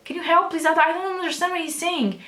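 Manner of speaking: pleading for help. Retold or imitated. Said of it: "I was like".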